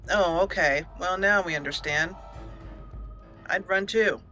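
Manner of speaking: ordinary talk, nothing marked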